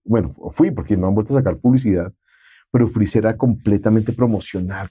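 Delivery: ordinary talk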